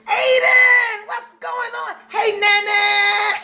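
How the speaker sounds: high, loud shouted greeting; excited shout. Real, not acted. Host shouting hello to her viewers, loud. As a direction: shout hello across the room.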